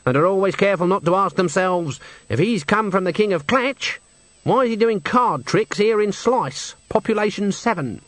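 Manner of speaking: sceptical Granny. A narrator's reading. Granny questions why a conjurer plays Slice, sceptical character voice. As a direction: question it as a sceptical old witch.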